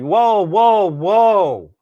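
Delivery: high, loud mock alarm; retold whoa. Retelling stopping the coffee shop gal, high surprised whoa. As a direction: throw up a startled whoa, whoa, whoa. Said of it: "I said".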